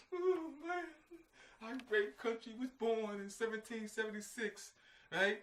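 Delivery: high-pitched put-on gushing patriot; mock proud patriot. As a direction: gush about the great country in a put-on voice. Mocking proud American voice.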